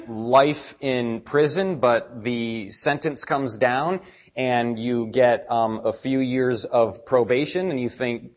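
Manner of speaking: ordinary talk, nothing marked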